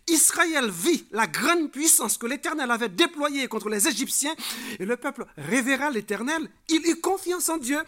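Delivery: loud, high proclamation of the verse; emphatic scripture reading. Acted. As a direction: read it out as a triumphant declaration. (lecture biblique proclamée à voix forte)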